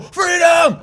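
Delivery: high hammed-up yell of Freedom; mock freedom cry. Bystanders at the eagle, high mock freedom yell. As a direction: shout it like a crowd. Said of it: "so many people go".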